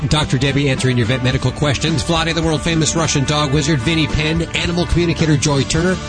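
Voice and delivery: ordinary talk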